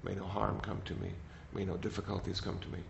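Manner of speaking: soft, gentle recitation of a blessing; softly reciting loving kindness phrases; recited prayer. Shown as a demonstration. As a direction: murmur it like a quiet prayer. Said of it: "just to recite that over and over".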